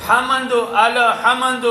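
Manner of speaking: lam and meem bounced in الحمد; mispronounced qalqalah. Shown as a demonstration. Teacher shows both wrong forms, bouncing lam and meem. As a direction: add a short echoing vowel after lam and meem in الحمد. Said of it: "دونوں صورتیں غلط ہوگی".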